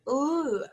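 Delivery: "ooh" as a long, held L sound; isolated first sound. Shown as a demonstration. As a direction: hum a sustained L on "ooh". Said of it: "What is the first sound".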